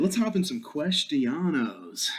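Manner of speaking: "questionos" with an added -os ending; playful word-mangling. Pretend. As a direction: stretch "questionos" into mock Spanish.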